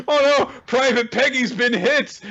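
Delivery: high, hammed-up cartoon panic; mock alarm. Cartoon soldiers seeing a comrade get hit, mock alarm. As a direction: cry out in mock battlefield horror. Acted cartoon battle dialogue.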